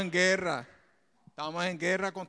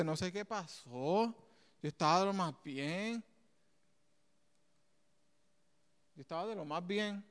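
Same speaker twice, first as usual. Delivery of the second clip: quiet retelling; 7 dB quieter than usual. Retold or imitated. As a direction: echo a friend's lost confusion gently. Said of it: "un amigo me dice".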